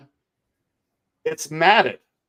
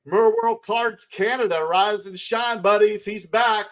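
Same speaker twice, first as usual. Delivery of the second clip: excited greeting; 7 semitones higher than usual. Real, not acted. Greeting returning chat viewer.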